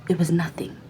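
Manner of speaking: ordinary talk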